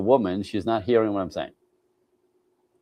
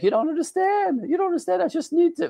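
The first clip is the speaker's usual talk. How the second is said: much higher, hammed-up woman's voice pleading; mock woman's whine. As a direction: play a frustrated woman, high and whiny. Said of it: "women are like".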